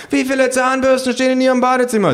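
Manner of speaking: very high, loud question; high-pitched survey hunter; hounding sociologist. Acted. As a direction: badger them in a high put-on voice. Sociologists joining the chase.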